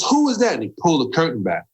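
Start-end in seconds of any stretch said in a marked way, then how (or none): 0.0-0.5 high-pitched mock yell; retold yelling. Young director yelling from behind a curtain, mock yell. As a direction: imitate a director yelling across the set. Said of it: "he's yelling and screaming".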